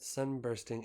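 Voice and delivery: ordinary delivery, nothing marked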